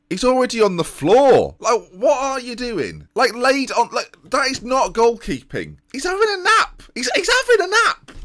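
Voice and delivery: high, incredulous outburst; exasperated disbelief. Real, not acted. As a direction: voice shooting up in outraged disbelief. Disbelief at keeper lying down.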